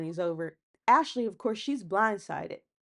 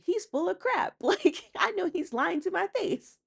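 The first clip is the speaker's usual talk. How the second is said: mock scoffing; 10 semitones higher than usual. Voicing Ashley's scoffing diary room.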